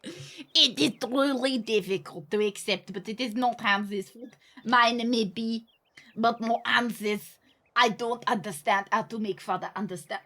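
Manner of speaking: put-on slow, thick French accent. French teenager writing of her brother, slow French accent. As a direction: labour through it in a hammed-up French accent. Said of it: "Do you want me to say it with a French accent?".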